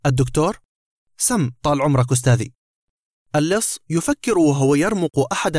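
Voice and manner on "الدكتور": plain reading voice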